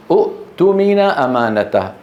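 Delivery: أؤتمن with a short u and a glottal stop before tu; original hamza form. Shown as a demonstration. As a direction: break أؤتمن after the first u. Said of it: "ماذا نقول؟". (نطق «أؤتمن» بهمزة ساكنة محققة)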